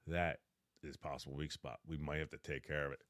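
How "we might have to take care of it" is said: plain reading voice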